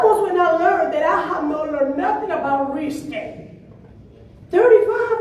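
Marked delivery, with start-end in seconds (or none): 4.5-5.2 shocked score. Retold or imitated. Recalling shock at low score.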